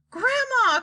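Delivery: high, joyful call to his grandma; excited cry. Acted. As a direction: rush to her, calling out happily. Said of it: "cried Charlie".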